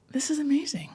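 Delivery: breathy awed whisper. Retold or imitated. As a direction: whisper it to yourself in wonder. Inner thought at a moving moment.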